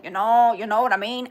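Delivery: loud mock outburst, imitating her; crazy girl. Streamer imitating a crazy girl online, loud mock blurting. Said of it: "It was this crazy girl".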